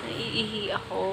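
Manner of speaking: library whisper. Real, not acted. Quiet spot, hushed voices.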